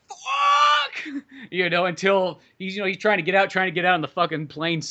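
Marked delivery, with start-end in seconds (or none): 0.1-1.1 sudden high shout of panic; retold shout. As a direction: yell it like you're trapped underwater. Pilot trapped in sinking plane.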